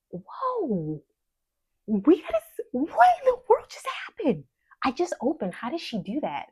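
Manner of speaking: high, loud shocked exclamation; astonished, high-pitched outburst; stunned disbelief. Real, not acted. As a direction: blurt it out in real shock, pitch leaping up. First sale arrives seconds after opening.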